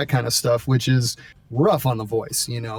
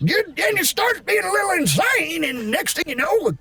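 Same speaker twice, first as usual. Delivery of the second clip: gritty voice amped up; 12 semitones higher than usual. Shown as a demonstration. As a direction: gravelly voice climbing into high-pitched insanity. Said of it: "amp it up".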